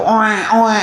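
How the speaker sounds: imitating a baby's wail oe oe; newborn cry. Preacher mimics a newborn baby, crying.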